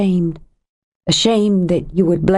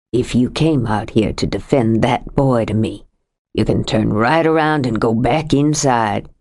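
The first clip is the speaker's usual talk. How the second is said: lightly voiced older man, lower, heavy with grief; a lightly lowered voice for a grieving husband; grieving Sam; 6 semitones lower than usual. Sam rebuffs Kate.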